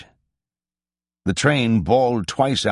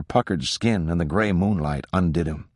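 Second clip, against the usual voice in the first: ordinary delivery, nothing marked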